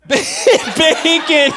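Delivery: a real burst of laughter around "Bacon"; laughing. Real, not acted. Preacher laughing at his own bacon joke, laughing.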